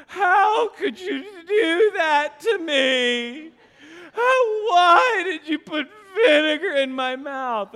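high, slow, mock-sobbing little boy; mock crying child. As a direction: whimper it like a betrayed kid. Son's tearful reply after vinegar.

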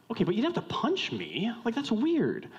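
high-pitched, incredulous objection; indignant protest. Acted. Wronged friend answers a weak excuse, indignant. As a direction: push back, baffled and put out. Acted quarrel over being punched.

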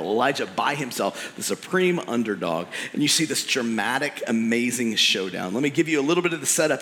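ordinary talk, nothing marked

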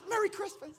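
high, strained echo of her voice; mock choked-up reply. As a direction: answer her in a squeaky mock-tearful voice.

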